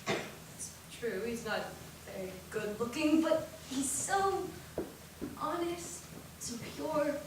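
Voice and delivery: soft, low, gentle sisterly voice; tender advice. Acted. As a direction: counsel her softly as a loving sister. Older sister gently advises.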